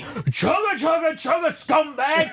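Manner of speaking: imitating a drill instructor screaming at a private; drill sergeant yell. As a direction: bark it like a drill sergeant chewing out a recruit. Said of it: "yelling that at me".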